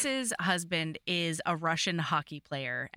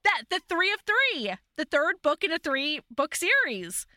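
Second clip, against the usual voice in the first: bursting out much higher and louder, thrilled; excited yes; 8 semitones higher, 4 dB louder than usual. Real, not acted. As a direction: jump in with delighted agreement. Excited agreement about the book.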